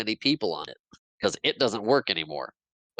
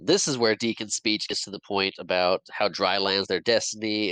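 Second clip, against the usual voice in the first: usual speaking voice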